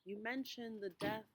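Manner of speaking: unhurried meditation voice with long pauses; guided meditation. Real, not acted. Pastor walking congregation through a walk to Emmaus, guided meditation. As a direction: guide listeners gently, phrase by phrase, leaving space to breathe.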